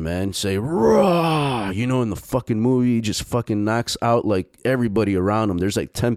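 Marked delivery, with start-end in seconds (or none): 0.6-1.7 high, loud barbarian war cry; Conan battle roar. Retold or imitated. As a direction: let out a Conan-style roar like a warrior. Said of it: "You fucking do Conan the Barbarian".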